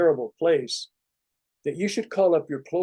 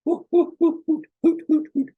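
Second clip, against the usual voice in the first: ho ho and hoot pushed way up high and louder; high hooting laugh; 14 semitones higher, 4 dB louder than usual. Shown as a demonstration. Laughing practice building to high, loud mock hoots. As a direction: whoop it up like a silly owl laugh. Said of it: "you know, you go".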